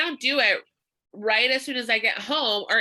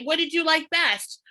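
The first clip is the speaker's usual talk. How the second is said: excited question; 6 semitones higher than usual. Shown as a demonstration. The seller asks the lead with excitement. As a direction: ask it brightly. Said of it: "That's how we talk".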